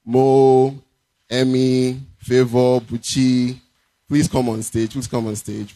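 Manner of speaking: normal talking voice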